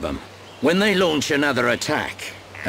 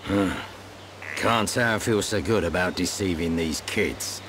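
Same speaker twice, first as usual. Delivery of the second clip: softer, low private mutter; mumbling to himself; 5 semitones lower, 5 dB quieter than usual. Acted. Veteran admitting his guilt to himself, quiet mumble. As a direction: grumble it under your breath, guilty. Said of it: "Oh, just mumbling".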